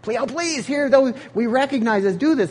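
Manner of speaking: high, imploring plea; pleading. Pretend. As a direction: beg Paul to let them give. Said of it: "They were pleading".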